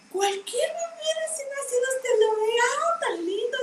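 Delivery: high, soft doting coo; cooing over a baby. Retold or imitated. Grandmother cooing over any newborn baby, high and soft.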